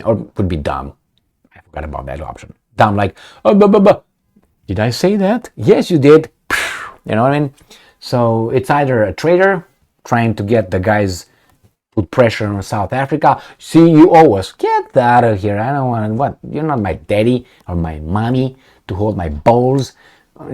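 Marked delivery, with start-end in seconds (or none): 3.4-6.3 high, loud dopey babble; a hammed-up fool, much higher; mock dumb guy. Voicing a dumb minister. 13.6-19.9 mock quarrel. Imagined Americans vs South Africa quarrel.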